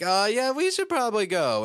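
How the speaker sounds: high, nervous mock squeak. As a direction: squeak it out like a scared kid. Nervous characters voiced in recap.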